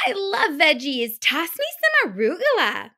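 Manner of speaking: put-on high, loud, pushy child; bossy demanding girl. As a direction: bark orders like a spoiled kid. Girl delighted by vegetables.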